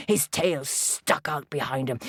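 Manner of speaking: ordinary delivery, nothing marked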